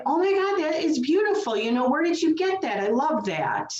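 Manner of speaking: high, fast, over-the-top gushing; gushing compliment. Retold or imitated. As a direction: flatter the daughter as if thrilled. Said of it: "I will give the daughter a compliment".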